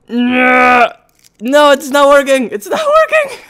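loud rising shriek breaking into laughter; panicked shriek. Real, not acted. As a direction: climb to a high squeal of frustration. Pins fail and hurt.

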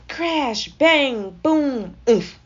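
loud, punched burst of impact words; crashing sound words. A narrator's reading. Narrator voicing the snowball smash, loud exclamations. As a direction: slam each word out high and loud.

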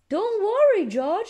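high, upbeat reassurance; bright. A narrator's reading. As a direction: cheer up a child brightly. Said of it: "says Mummy Pig brightly".

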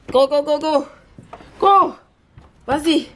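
high-pitched repeated commands; urging a dog. Real, not acted. Owner sends her dog off outside, high urging commands. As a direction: urge on in a high voice.

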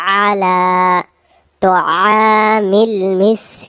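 slow melodic tajweed chant; Quran recitation. Acted. Teacher recites verses for students, slow melodic chant. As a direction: draw the vowels out in a chant.

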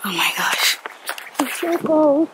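ordinary talk, nothing marked

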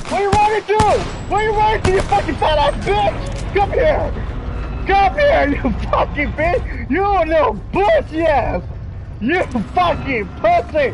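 high, loud yelling at a fleeing opponent; real heated, high-pitched shout; angry gamer shouting. Real, not acted. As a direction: scream insults while giving chase. Chasing a fleeing enemy in game.

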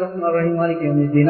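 rattled off low and soft, like a computer playback; quick mechanical gabble, no attention to the words; mindless rote recitation. Shown as a demonstration. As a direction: run through it on autopilot. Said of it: "அப்படியே ஓதிக்கொண்டே போவோம்".

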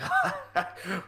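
a sudden high, mocking cackle at the recruits; soldier's mocking laugh. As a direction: laugh at them like a seasoned soldier. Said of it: "the one in the back just starts laughing".

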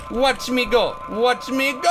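loud, high and building; excited boast. Real, not acted. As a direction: shout it while charging ahead. Boasting as he launches.

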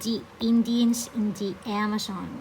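slow, careful English read-aloud. Shown as a demonstration. Teacher reading the sentence slowly to her students. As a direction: read it word by word for the class.

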